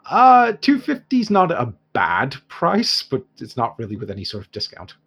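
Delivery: put-on haggling dwarf merchant in an Irish accent; dwarf shopkeeper in an Irish accent haggling with the heroes. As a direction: bargain in a lilting Irish dwarf voice.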